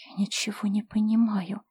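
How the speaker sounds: frightened faint whisper; babbling whisper. Acted. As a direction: whisper it in terror. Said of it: "пролепетал монах Леонель".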